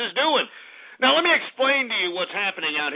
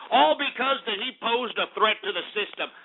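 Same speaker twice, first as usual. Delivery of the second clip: ordinary talk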